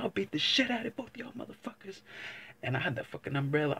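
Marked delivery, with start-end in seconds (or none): 0.0-2.0 high mock threat. Man bluffing to two pit bulls, high mock threat. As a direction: threaten the dogs, pitched up high. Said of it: "I said".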